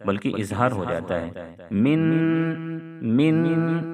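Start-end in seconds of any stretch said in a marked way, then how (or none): 1.7-3.9 من with the ن pronounced fully, tongue on the palate; nun without nasal hiding; wrong clear nun. Shown as a demonstration. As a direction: show the mistake, a plain open ن in من. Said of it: "اس طرح سے نون کو ظاہر نہیں کرنا ہے".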